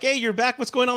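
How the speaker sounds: high, bright-eyed mock welcome; acted delighted hello; happy greeting. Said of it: "Michael's probably happy to see you".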